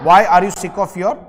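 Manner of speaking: very slow and loud, each word drawn out; loud slow shout. Real, not acted. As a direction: call it out slowly to the class. Teacher calls out to class. (कक्षा से पूछते हुए धीमी ज़ोरदार पुकार)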